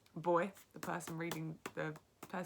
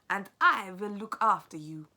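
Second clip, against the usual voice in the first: louder firm grandmother voice; 4 semitones higher, 14 dB louder than usual. Acted. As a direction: reassure the boy as grandma. Grandmother comforts the boy.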